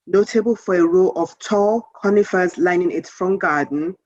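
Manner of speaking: ordinary delivery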